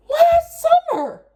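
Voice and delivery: high, loud exasperated wail on last summer; exasperated cry. Real, not acted. Venting at her grandma about the job search, a loud high wail.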